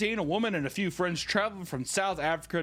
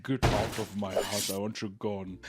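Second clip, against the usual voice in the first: put-on lightly Dutch-accented, lower voice; 8 semitones lower than usual. Host ordering guests out, mild Dutch accent. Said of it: "Is that an Amsterdam accent?".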